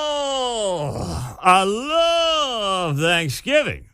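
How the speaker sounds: drawn-out, high swooning mock voice; Rex Archer. Host mocking health director's famous Thanksgiving line, hammed-up imitation. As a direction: stretch it out in a high, hammed-up gush. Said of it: "says Rex Archer".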